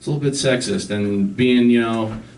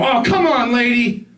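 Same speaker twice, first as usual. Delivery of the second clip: dad road rage; 8 semitones higher, slower than usual. Retold or imitated. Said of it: "he would do this thing where he would".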